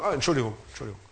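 offhand apology. Retold or imitated. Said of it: "Leute sagen".

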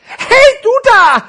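very high, very loud shout; high-pitched storybook yell; little parcel calling out. Acted. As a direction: holler up as a tiny, squashed character. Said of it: "rief das kleine Päckchen".

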